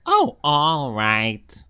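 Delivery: low, slow-spoken pigeon character; a lightly voiced kindly pigeon; pigeon puppet voice. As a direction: play Mister P the friendly pigeon. Pigeon gives in.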